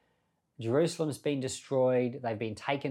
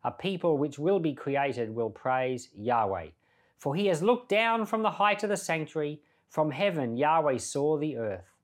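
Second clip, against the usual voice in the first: a measured, raised reading of the psalm; scripture reading; 3 semitones higher than usual. Acted. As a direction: read the psalm aloud with feeling. Reads psalm aloud.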